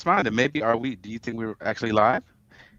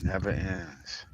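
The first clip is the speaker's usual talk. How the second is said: ordinary delivery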